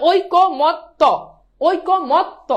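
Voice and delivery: "ঐক্যমত্ত" slow in separate beats, oi-ko-mot-to; ভাঙা উচ্চারণ. Shown as a demonstration. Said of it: "এটার উচ্চারণ হচ্ছে". ("ঐক্যমত্ত" থেমে থেমে, শেষে ত্ত)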